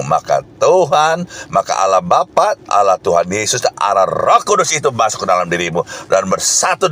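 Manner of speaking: loud, high-pitched revival preaching; fiery preaching. Acted. Preacher rouses the congregation about their power, at full force.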